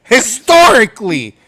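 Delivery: high, loud indignant ranting. Real, not acted. Fan ranting about the coach loving Broncos, loud. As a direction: hammer each point home.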